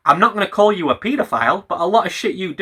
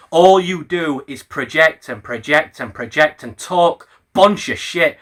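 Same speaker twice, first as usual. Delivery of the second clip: usual speaking voice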